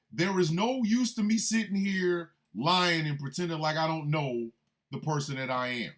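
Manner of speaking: usual speaking voice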